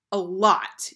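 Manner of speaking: loud, firm insistence; emphatic defensive retort; insistent. A narrator's reading. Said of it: "he insisted".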